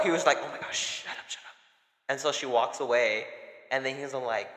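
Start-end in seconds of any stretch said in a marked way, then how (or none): 0.4-1.5 urgent hushed whisper; retold whisper. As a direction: hiss it so mom won't hear. Panicked quote as mom knocks.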